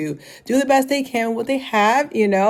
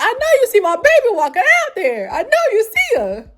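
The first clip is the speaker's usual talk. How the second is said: Southern grandmother. Retold or imitated. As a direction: scold the crowd like a proud Southern grandma. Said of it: "turned into a Southern grandmother".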